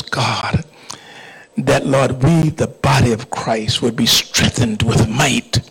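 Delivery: impassioned pulpit prayer, swelling then settling; fervent prayer. Real, not acted. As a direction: plead with God on the congregation's behalf. Leads the congregation in prayer.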